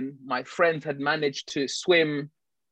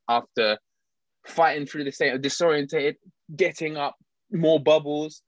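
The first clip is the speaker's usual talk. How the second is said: ordinary talk, nothing marked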